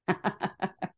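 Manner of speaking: soft breathy laugh under her breath; quiet laugh. Real, not acted. Coach laughing quietly at her past self, soft chuckle.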